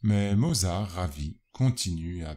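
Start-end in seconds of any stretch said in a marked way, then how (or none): none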